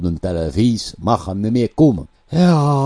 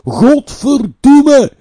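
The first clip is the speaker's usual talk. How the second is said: much higher, louder, slow drawn-out shout of "Godverdomme"; retold furious curse; 9 dB louder, much slower than usual. Politician shouting at the press at his door. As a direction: bellow the curse as the politician did. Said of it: "hij riep".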